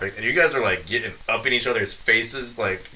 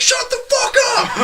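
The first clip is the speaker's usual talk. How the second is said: high-pitched shouted order; mock yell; 5 dB louder than usual. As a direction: bellow it at the crowd like an angry cop. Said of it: "and they're like".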